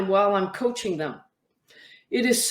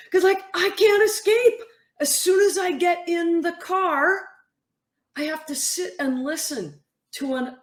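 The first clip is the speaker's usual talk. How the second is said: exasperated athlete; 6 semitones higher than usual. Retold or imitated. Athlete's complaint retold by coach.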